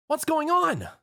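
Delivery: very high frightened shriek. A narrator's reading. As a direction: shriek in panic. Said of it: "Twilight shrieked".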